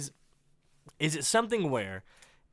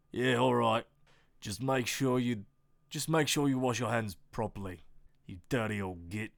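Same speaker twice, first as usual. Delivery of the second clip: deep Australian man; 5 semitones lower than usual. Acted. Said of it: "deep voice Australia man".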